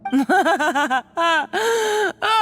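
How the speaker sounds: high, mocking laughter; amused laugh. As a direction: laugh her rival off. Keeps laughing at rival.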